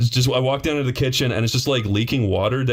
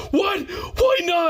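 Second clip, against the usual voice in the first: high alarmed shout; shouting plumber; slower than usual. Retold or imitated. Plumber hearing the rattling tub, high panicked shout. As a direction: yell it up high in comic panic.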